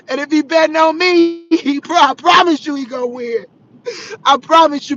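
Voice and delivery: loud, tearful promise; real tears. Real, not acted. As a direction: push through the tears as you swear it. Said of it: "And I'm gonna be crying".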